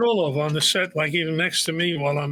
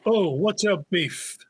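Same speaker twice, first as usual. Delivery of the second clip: slow, higher-pitched tough challenge; intimidating; 4 semitones higher, slower than usual. Shown as a demonstration. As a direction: draw it out like a confrontation. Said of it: "Yeah, like this".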